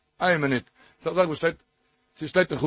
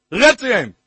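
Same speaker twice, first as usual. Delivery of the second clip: loud, raised command; emphatic exhortation; 4 semitones higher, 9 dB louder, much slower than usual. Real, not acted. Preacher urging listeners to talk to God, emphatic exhortation. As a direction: punch it out as a refrain.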